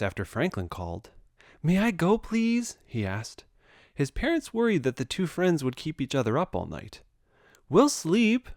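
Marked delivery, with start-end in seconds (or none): 1.6-2.8 high, begging little voice; pleading child voice. A narrator's reading. Bear begs parents. 7.7-8.5 high, eager little promise; earnest promise. A narrator's reading. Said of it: "promised Bear".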